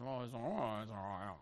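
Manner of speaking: low, quiet grumbling under the breath; muttered grumble. Retold or imitated. Grumbling quietly to himself as the person walks away. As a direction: mutter it once they're out of earshot.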